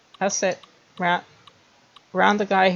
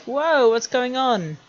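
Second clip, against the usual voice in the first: mock surprised whoa; 6 semitones higher, slower than usual. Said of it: "going like".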